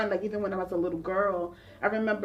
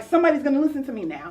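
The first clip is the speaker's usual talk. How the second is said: excited; 6 semitones higher, 8 dB louder, faster than usual. Retold or imitated. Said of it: "I was just so excited about that".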